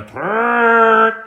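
loud slow trumpeting raspberry; elephant trunk raspberry. A narrator's reading. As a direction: blow it like an elephant.

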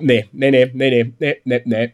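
usual speaking voice

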